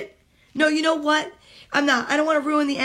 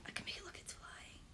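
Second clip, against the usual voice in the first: whisper; 27 dB quieter than usual. Real, not acted.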